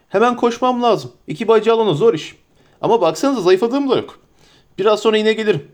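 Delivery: a flustered, fussing landlord; bustling innkeeper. Acted. Innkeeper Butterbur welcoming the hobbits, eager and fussy.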